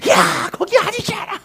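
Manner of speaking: very high, stretched-out mock yell. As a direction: holler corrections like a coach up in the stands. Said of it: "똑같이 목소리 막 두 배".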